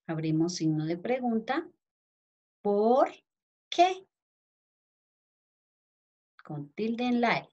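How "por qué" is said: spaced out, ending high on qué; dictation. Shown as a demonstration. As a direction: dictate the question slowly. Dictating question.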